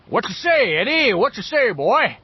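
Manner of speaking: booming, high, grandly rolling Southern gentleman; Foghorn Leghorn. A narrator's reading. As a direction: hail him as a cartoon Southern blusterer. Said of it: "in his grandly rolling Southern gentleman voice".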